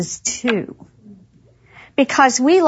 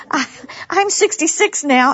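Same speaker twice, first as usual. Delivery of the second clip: ordinary talk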